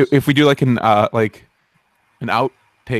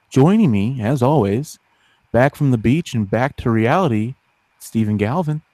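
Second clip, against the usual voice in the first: normal talking voice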